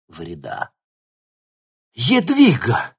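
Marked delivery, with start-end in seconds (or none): 1.9-2.9 high, drawn-out stunned cry; loud, slow, astonished exclamation; shocked. A narrator's reading. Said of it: "потрясённо выдохнул Кривоносов".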